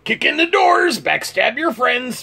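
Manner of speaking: high, punchy catchphrase; shouted sign-off. Acted. Closing the video with a high, punchy catchphrase. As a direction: belt out the show tagline.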